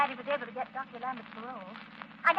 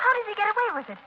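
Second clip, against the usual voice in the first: much higher and louder, disbelieving; indignant surprise; 11 semitones higher, 10 dB louder than usual. Acted. Margot asks Lamont in indignant disbelief. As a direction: she can't believe he gets away with it.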